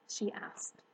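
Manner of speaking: usual speaking voice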